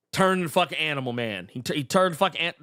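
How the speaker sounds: ordinary talk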